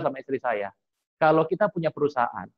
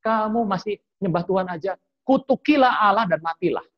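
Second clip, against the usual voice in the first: higher-pitched, scolding outburst, retold; angry wife; 6 semitones higher, 3 dB louder than usual. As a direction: imitate an angry wife cursing her husband. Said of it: "istrinya marah".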